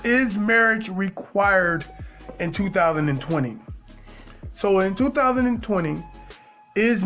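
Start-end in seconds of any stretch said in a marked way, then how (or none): none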